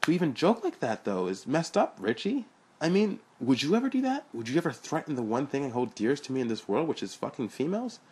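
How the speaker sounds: high, loud outraged ranting; indignant rant. Acted. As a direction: rant in hurt disbelief. Sean ranting, hurt and indignant.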